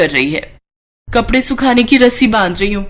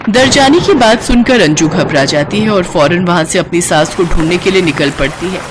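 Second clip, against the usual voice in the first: normal talking voice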